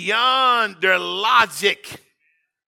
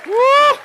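high, ringing shouted woo; worship whoop; 14 semitones higher, 5 dB louder than usual. Real, not acted. Preacher whooping to the church in a joyful cry.

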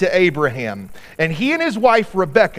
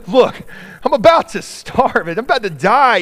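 louder, higher desperate plea; desperate pleading; 6 semitones higher, 3 dB louder than usual. Acted. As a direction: beg like you're starving. Esau pleading, starving.

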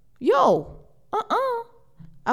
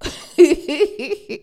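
laugh; 6 semitones higher, 4 dB louder than usual. Real, not acted. Laughing at her own joke.

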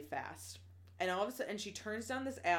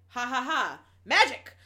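loud, cackling cry of triumph; loud gloating magic reveal; gleeful triumph; 5 semitones higher, 12 dB louder than usual. Retold or imitated. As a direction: crow it out like a showoff with fire. Lila gleefully fights off attackers.

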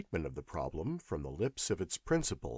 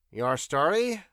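higher, louder clipped prompt; curt professor; 6 semitones higher, 6 dB louder than usual. Acted. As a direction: cut to the point.

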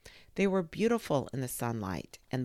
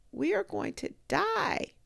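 rising cry of leaves. A narrator's reading. Leaves cry out their fear to each other, high. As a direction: let the fear rise up high. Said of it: "her little leaves saying to each other".

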